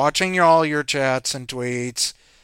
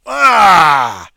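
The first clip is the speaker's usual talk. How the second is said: exclaim; 7 semitones higher, 18 dB louder than usual. Real, not acted. Exclaims after flubbing the name.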